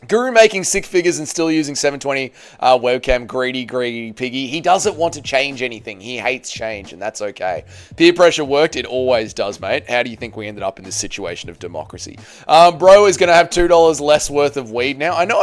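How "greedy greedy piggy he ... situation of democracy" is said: ordinary talk, nothing marked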